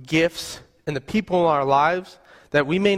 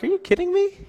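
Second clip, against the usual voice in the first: much higher, mock-incredulous squeal at herself; 14 semitones higher, faster than usual. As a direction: scold yourself in disbelief in the mirror. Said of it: "I'm like".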